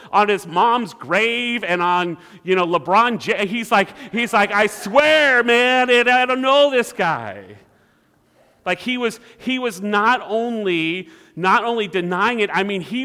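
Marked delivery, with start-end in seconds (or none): 4.5-7.6 loud, high, hammed-up protest; swearing denial. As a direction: shout it like Peter desperately disowning a friend. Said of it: "he was cussing and swearing".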